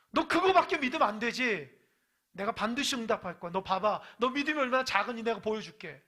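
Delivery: high, loud scolding as God; God scolding the faithless, loud and high; God's rebuke. Acted. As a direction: thunder down a rebuke on the doubter.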